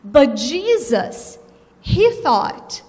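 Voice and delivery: normal talking voice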